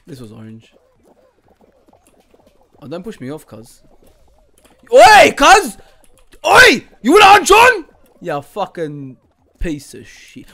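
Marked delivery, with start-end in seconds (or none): 4.9-7.8 very loud, much higher calls; yelling. Real, not acted. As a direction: shout across the map at him. Yelling at another player.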